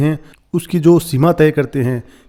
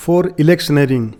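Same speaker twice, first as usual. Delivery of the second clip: "for electioneering" read very slowly, word by word; slow dictation-style read; slow quoting; much slower than usual. Shown as a demonstration. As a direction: drag each word out for learners. Teacher reads article phrase.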